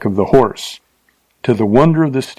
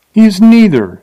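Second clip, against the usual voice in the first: high, louder, emphatic denial; scornful; 10 semitones higher, 6 dB louder than usual. A narrator's reading. Girl answers Bill's question about the rider, scornfully. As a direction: throw it back at him with scorn. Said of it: "she replied scornfully".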